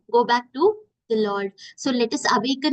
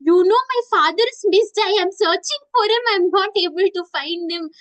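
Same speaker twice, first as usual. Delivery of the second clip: higher and louder, tearful telling; crying child; 6 semitones higher, 4 dB louder than usual. Retold or imitated. Lost child telling the neighbour uncle her father is missing. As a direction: sob it out to the uncle. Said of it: "And I tell".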